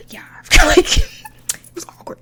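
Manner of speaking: breaking into high laughter; laughing through words. Real, not acted. Telling friends about the awkward night while laughing. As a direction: giggle through the line, slowed.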